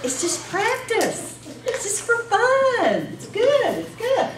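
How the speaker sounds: high, sing-song soothing tone; reassuring. Real, not acted. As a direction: gently soothe a nervous room. Calming nervous contestants before practice.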